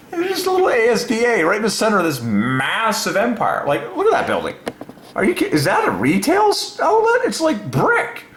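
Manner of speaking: very high incredulous squeal; squealing disbelief. Real, not acted. Looking at the ASDA headquarters, squealing in disbelief. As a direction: gasp at the tiny sign.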